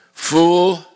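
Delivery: Fool lifted and stressed in rebuke; exclaimed. Acted. God rebuking the rich man, stern exclamation. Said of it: "with an exclamation point".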